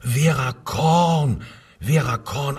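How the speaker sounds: loud, high, drawn-out dawning remembrance; feigned recognition. Acted. Suspect faking sudden memory for the interrogator. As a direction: act as if the name just came back.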